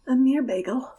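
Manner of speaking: whispering. Pretend. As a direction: whisper the joke aside. Jokey whispered pun.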